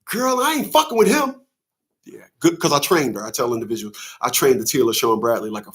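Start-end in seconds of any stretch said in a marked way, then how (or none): 0.0-1.4 high mock girl voice; mocking her.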